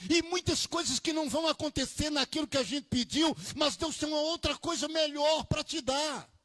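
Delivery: rising, loud preacher's cadence; fervent sermon delivery; preaching at full force. Acted. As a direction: preach it out to the congregation, building up.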